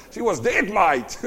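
amused, laughing on "mate"; laughing punchline. Real, not acted. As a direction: crack up while landing the joke.

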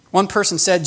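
ordinary talk

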